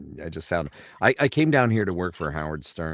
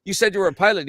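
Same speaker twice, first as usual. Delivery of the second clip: high, loud Newfoundland challenge; indignant Newfoundland-accented boss; 12 semitones higher, 6 dB louder, faster than usual. Acted. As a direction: challenge him in a Newfie accent. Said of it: "jump the pond over to Newfoundland".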